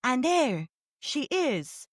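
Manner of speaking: very slow, dragged-out audiobook read; painfully slow narration. Retold or imitated. Mocking the slow audiobook she hated, dragging every word. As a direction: crawl through each word like a sluggish narrator. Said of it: "and it was horrible".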